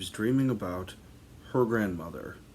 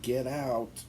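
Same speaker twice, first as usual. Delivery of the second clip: muffled into pillow; 5 semitones higher than usual. Retold or imitated. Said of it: "muffled into the pillow".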